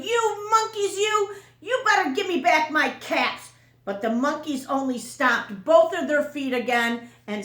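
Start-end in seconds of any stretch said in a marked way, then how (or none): 0.0-3.5 high, loud angry shout; shouting peddler. Acted. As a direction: shout at the monkeys in rage. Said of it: "stomped both his feet and shouted".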